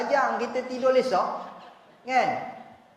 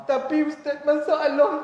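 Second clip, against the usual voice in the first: higher, louder pleading voice; complaining husband; 6 semitones higher, 5 dB louder than usual. Retold or imitated. Husband complaining to the ustaz, pleading. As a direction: whine out the problem.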